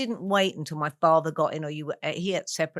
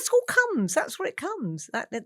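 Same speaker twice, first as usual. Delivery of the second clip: normal talking voice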